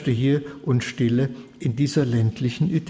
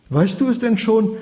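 high, eager urgent question; higher urgent repeated question; urgent asking; 7 semitones higher than usual. Acted. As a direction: press him for the news.

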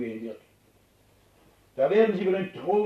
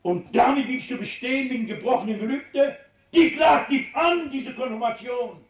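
booming accusation; 6 semitones higher, 4 dB louder than usual. Acted. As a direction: hurl the broken vow back at him. God accuses again.

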